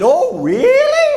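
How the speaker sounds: very high, slow squeak; squeaky mock surprise. Student told he has serious English difficulties, squeaky mock-surprised reply. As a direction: squeal it out, drawn out and feigning surprise. Student answers professor's criticism.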